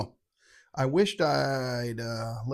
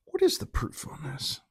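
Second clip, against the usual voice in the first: 1 whispered aside. Real, not acted. Whispered question to self.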